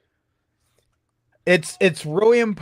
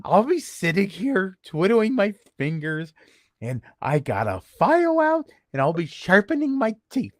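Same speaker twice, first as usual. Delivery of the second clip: creepy voicemail voice. Retold or imitated. Leaving a creepy voicemail for the association president. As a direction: menace him playfully into calling back. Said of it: "And that was the voicemail".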